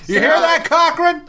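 high, loud mock drunk call; mock drunk shout. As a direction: bellow the name like a drunk. Acting the movie drunk.

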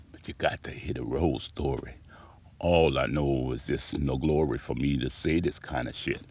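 hushed, breathy aside; confiding whisper to the audience. Real, not acted. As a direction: lean in and whisper it to them.

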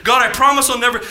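loud, high mock promise; earnest vow. Preacher mimicking believers who have all done it, loud high vow. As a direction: blurt out a fervent pledge.

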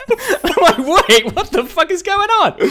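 high, loud, laughing outburst; laughing disbelief. Retold or imitated. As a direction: laugh out the confusion. Baffled reaction to bizarre ending.

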